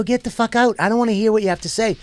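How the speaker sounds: high, quick brush-off; retold angry dismissal. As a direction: cut her off in mock irritation. Retold brush-off of pleading lady.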